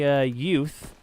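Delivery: ordinary talk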